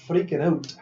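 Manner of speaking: high panicked burst; sudden loud alarm; freaking out. Retold or imitated. Friend panicking in tree story.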